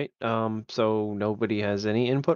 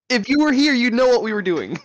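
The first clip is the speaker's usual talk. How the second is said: loud, much higher reproach; exasperated scolding; 12 semitones higher, 6 dB louder than usual. Real, not acted. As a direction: tell off someone who wasn't paying attention. Repeats scolding over the protest.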